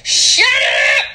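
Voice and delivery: high, laughing yell; comic outburst. Acted. Hushing the rowdy room, high comic shout. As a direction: yell it high to hush everyone.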